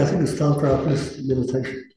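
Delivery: usual speaking voice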